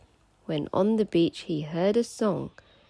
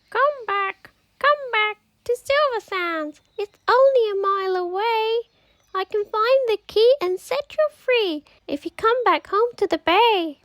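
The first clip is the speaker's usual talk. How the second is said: much higher, louder calling bird; seagull voice; 6 dB louder than usual. Acted. Seagull urging the mermaid to come home, high and eager. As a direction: coax her home like an eager gull. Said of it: "seagull speaks".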